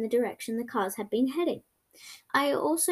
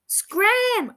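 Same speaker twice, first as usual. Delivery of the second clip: very high, loud shrill shout; screech; 10 semitones higher, 6 dB louder than usual. A narrator's reading. Girl screeching at the dogs to make them scram. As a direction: shriek it to drive them off. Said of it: "I screeched".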